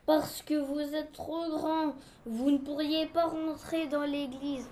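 very slow, measured character voice; solemn explanation, words stretched; ponderous official. Acted. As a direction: spell it out slowly and weightily. Reads the objector's part.

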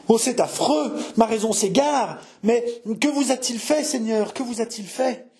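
high, appalled outcry; frantic distress. Acted. Jeanne demanding what François has done, frantic distress. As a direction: plead for answers as if losing your mind. Jeanne losing her reason.

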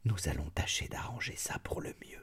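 quiet, grave, whispered reassurance; mother promising to settle the servant's marriage, gravely. A narrator's reading. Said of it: "reprit avec gravité".

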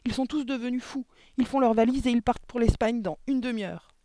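put-on high, loud panicked child; distressed cry. A narrator's reading. Girl reporting her family's sudden flight, distressed cry. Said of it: "cria-t-elle".

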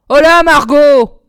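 loud, drawn-out shout across the room; impatient shout. A narrator's reading. Impatient witch shouting for Margot, loud and slow. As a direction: bellow the girl's name. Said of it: "cria-t-il à la fillette".

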